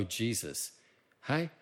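normal talking voice

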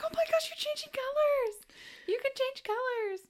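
very high, squealing mock delight; over-the-top excitement. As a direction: imitate someone gushing in amazement. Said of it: "She gets so excited".

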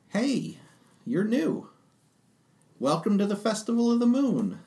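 high-pitched cheery Tabaxi greeter; Tabaxi festival-goer. Acted. Tabaxi welcomes the party to the festival, high character voice. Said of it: "to your party and says".

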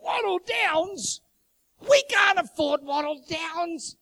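high, loud, drawn-out incredulous shout; hammed-up squawk of dismay, stretching every word; mock outraged disbelief. Husband aghast at expensive suburb.

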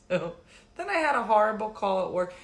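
ordinary talk

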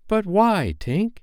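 high, pleading question; high-pitched bewildered appeal; distressed boy. Acted. Peter questions the dying fairy.

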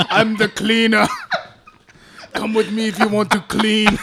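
hammed-up movie cyborg impression, laughing through it; Terminator. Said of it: "Is he the Terminator now?".

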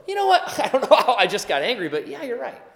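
laughing it off, high and bright; angry person laughing off their outburst; sheepish laugh. Retold or imitated. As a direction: give in with an embarrassed laugh. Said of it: "Do you ever just go".

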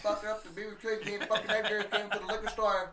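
put-on laughing Rez accent voice; Res accent. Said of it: "harshest fucking Res accent".

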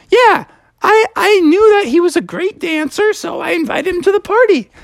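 zebra voice. Pretend. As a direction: pitch it up for the zebra.

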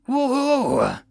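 high-pitched cheering "woohoo"; long excited whoop; joyful whoop. Acted. Door gunner cheering as he fires, joyful whoop.

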